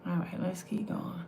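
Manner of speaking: whispered, to herself, moving on. Real, not acted. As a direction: breathe it out as a whisper. Calms herself, moves on.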